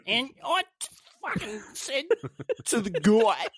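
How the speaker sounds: put-on high-pitched French take on an Australian accent; hammed-up Frenchman attempting broad Australian; bad French-Australian accent. Host imagines a French podcast imitating Australians, bad French-Australian accent. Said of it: "French podcast trying to do an Australian accent".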